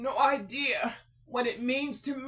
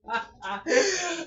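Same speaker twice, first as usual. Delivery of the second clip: overwhelmed laugh; 6 semitones higher than usual. Real, not acted. Overwhelmed release.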